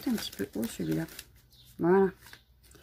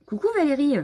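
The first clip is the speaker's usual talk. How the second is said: « coucou Valérie » lancé plus haut et fort; salut joyeux; 8 semitones higher, 7 dB louder, faster than usual. Real, not acted. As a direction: saluer Valérie avec entrain. Salue une spectatrice. (salut enjoué à une spectatrice)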